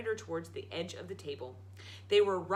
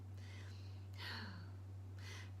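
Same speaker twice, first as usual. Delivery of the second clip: reluctant sigh; 16 dB quieter than usual. Acted. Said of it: "didn't want to perform".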